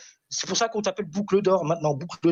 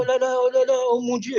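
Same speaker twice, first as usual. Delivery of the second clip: mock panic; 4 semitones higher than usual. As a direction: ham up being scared to mock him. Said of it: "je tremble comme une feuille".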